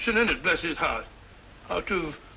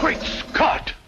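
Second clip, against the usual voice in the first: high startled gasp of shock; startled exclamation; 9 semitones higher than usual. Acted. Watson shocked as the dagger shoots up, startled cry. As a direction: jump back in shock.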